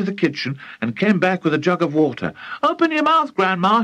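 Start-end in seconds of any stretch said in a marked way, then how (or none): 2.6-3.8 put-on high, urgent boyish call; crying out. A narrator's reading. George with a jug of water, crying out. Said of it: "he cried".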